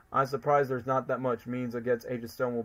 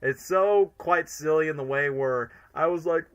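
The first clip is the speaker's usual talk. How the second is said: usual speaking voice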